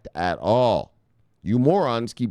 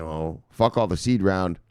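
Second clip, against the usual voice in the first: normal talking voice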